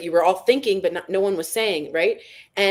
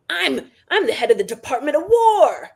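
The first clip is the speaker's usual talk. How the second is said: put-on pompous kid voice, a bit higher and louder; mock little boy; 7 semitones higher, 4 dB louder than usual. Mocking the war chief as a boy playing soldiers, puffed-up kid voice. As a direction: play a ten-year-old boy bossing the forest. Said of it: "ten-year-old boys playing in the forest".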